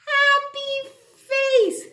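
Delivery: high, slow, cheery cartoon voice. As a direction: squeak it like a toy. Teacher says happy face in character.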